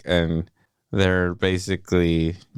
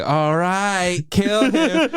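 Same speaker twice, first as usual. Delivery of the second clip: high, laughing kill order; imitating a friend, laughing and high; mock deadly; 11 semitones higher, slower than usual. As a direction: say it sweetly deadly. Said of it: "Lily was like".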